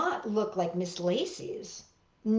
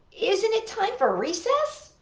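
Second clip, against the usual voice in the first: eager question; 11 semitones higher than usual. Acted. Eager child asking teacher.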